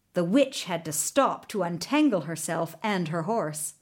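ordinary delivery

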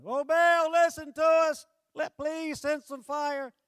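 high, loud, frantic wail to Baal; hammed-up desperate crying out to a god; mock screaming prayer. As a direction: priests screaming to Baal for fire. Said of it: "they start screaming out".

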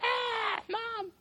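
very high, soft whine; embarrassed kid. Retold or imitated. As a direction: whine at mom. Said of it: "I was like".

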